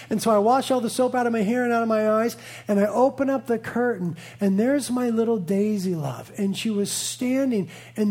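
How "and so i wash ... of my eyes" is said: ordinary delivery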